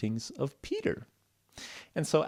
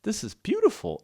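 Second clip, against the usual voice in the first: amazed Peter; 8 semitones higher than usual. Acted. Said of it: "And Peter said".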